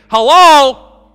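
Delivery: loud, high exasperated call; frustrated prayer. Pretend. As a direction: shout up at a silent God.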